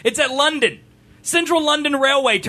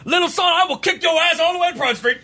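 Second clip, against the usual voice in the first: mock British threat; 7 semitones higher than usual.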